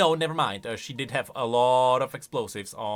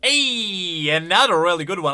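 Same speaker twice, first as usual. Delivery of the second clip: pleased recognition; 3 semitones higher, 7 dB louder than usual. Real, not acted. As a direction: greet the reference with a long, pleased hey. Reacting to a recognised quote.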